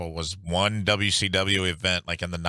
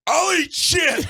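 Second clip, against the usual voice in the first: much higher, loud yell; wild shout; 5 dB louder, much slower than usual. Retold or imitated. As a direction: scream the offer to win. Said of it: "one dude was like".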